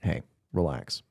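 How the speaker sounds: low, soft and slow; calm a fussy cat quietly; soothing. Real, not acted. Host talking down the cat that hissed, soft soothing.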